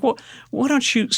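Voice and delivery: high, tentative-sounding retold offer; suggesting wife. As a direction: imitate your wife's helpful suggestion. Imitating wife's helpful suggestion.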